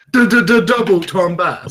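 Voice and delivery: high, loud stuttering DJ hype; stuttered hype announce. Pretend. Hyped announcer joke, laughing.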